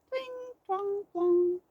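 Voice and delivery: high plucky twangs; banjo strumming sounds. A narrator's reading. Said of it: "Mouse strummed hard".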